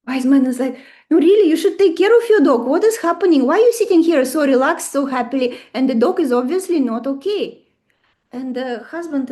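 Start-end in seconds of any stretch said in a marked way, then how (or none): 1.1-7.6 louder, faster pleading; urgent concern. Retold or imitated. Wise man urging the old couple about the dog, loud and fast. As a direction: press them hard to care.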